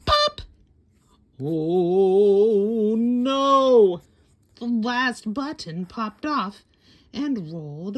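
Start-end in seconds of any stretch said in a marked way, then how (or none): 0.1-0.4 sharp, very high, loud pop; popping sound. A narrator's reading. Last button pops off. 1.4-4.0 loud shocked cry of dismay. A narrator's reading. Button pops off.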